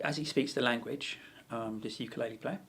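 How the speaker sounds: normal talking voice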